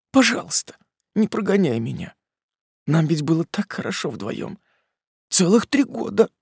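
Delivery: high, tearful begging woman. Acted. Polina begs not to leave.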